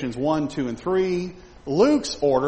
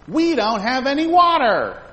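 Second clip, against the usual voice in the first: high, loud, slow whine; whiny thirsty Israelites; 11 semitones higher, 7 dB louder than usual. Retold or imitated. Thirsty Israelites complaining in the wilderness, high loud slow whine. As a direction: wail like parched complainers. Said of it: "Israelites complaining".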